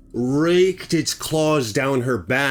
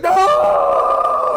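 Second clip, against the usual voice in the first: long, loud high-pitched wail; terrified scream; 6 dB louder than usual. Retold or imitated. Chris sees the bear dragging her away, terrified scream. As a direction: cry out in horror. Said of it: "Chris was terrified as shoot".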